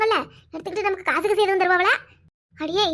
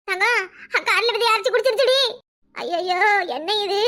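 ordinary talk

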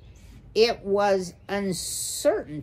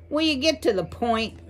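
higher, quick burst of exasperation; exasperated screech; 6 semitones higher, 3 dB louder, faster than usual. A narrator's reading. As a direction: cut in impatiently, pitched up. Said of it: "Mister Ocax screeched in expira".

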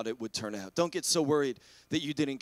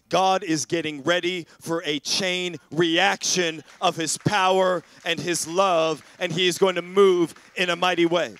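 measured preacher's declaration, growing louder; emphatic preaching; 5 dB louder than usual. Real, not acted. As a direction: proclaim it with force, word by word. Preaches a promise with force.

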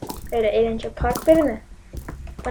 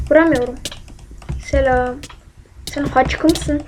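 usual speaking voice

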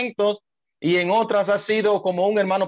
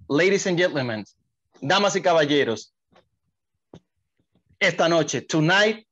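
lucha libre announcer; 2 semitones higher than usual. Acted. Announces the guest's name.

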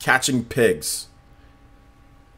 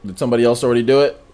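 ordinary talk

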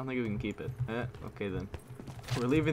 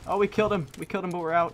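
higher, louder cheer of triumph; excited shout; 7 semitones higher, 5 dB louder, faster than usual. Real, not acted. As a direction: whoop over the kill. Scores a kill.

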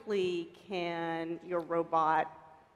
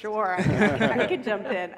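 laughing; 8 dB louder, slower than usual. Real, not acted. Laughing while volunteering to ask.

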